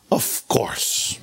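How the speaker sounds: breathy sarcastic of course; whispered aside. Real, not acted.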